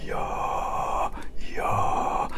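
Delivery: low, open-throat voiceless whisper; chiaroscuro whisper. Shown as a demonstration. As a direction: whisper with a settled, neutral larynx. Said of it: "I want you to get".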